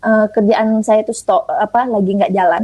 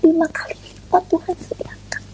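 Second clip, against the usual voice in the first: breathless whisper of wonder; awed whisper; 7 semitones higher, 6 dB quieter than usual. Real, not acted. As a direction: whisper it like a miracle. Awed testimony of God's provision.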